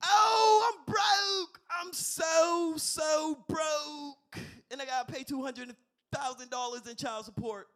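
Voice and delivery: high loud wailing shout. Retold or imitated. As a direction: wail like a broke rapper. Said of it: "this is my second impression".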